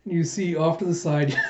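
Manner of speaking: ordinary delivery, nothing marked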